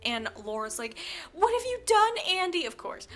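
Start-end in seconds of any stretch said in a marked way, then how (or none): 1.3-2.7 very high, loud accusing cry; hammed-up outraged wail; horrified shriek. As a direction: imitate an appalled mother screaming. Mother arrives, shrieks in horror.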